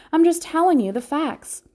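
put-on high, loud indignant child; girl protests her mother's accusation, indignant and high. A narrator's reading. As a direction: protest she's telling the truth. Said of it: "Fern protests".

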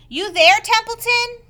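loud, high call across the yard. Acted. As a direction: call the rat from afar. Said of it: "he called".

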